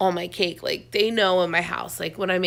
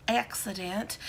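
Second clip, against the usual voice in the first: ordinary talk, nothing marked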